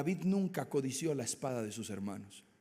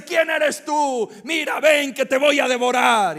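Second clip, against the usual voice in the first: high, loud roaring giant; booming Goliath; 10 semitones higher, 12 dB louder, slower than usual. Acted. Goliath taunting David, loud and roaring. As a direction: roar it out like a mocking giant. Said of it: "Goliat se reía y dice".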